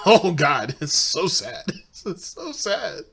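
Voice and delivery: slow words through laughter; laughing. Real, not acted. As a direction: laugh through mock pity. Laughing at the retold excuse.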